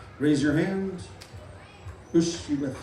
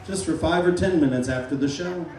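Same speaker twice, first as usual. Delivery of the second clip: usual speaking voice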